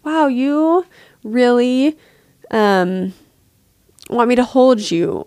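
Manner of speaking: gentle acknowledging. Shown as a demonstration. Mother soothing her crying baby, slow gentle acknowledging voice.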